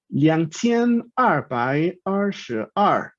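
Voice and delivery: slow, syllable-by-syllable Mandarin numbers; Mandarin pronunciation demo. Shown as a demonstration. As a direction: sound out each Mandarin syllable slowly, tones distinct.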